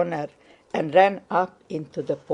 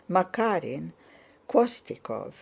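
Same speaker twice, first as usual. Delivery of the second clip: ordinary delivery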